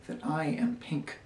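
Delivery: soft whisper, like a secret; whispered confession. Acted. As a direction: whisper it privately. Confides a secret after pause.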